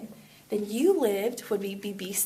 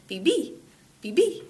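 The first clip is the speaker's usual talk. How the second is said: "B B" as Spanish viví, second syllable punched high; stress on final I; 3 semitones higher than usual. Shown as a demonstration. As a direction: hit the second "B" of each "B B". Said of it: "put stress on this I".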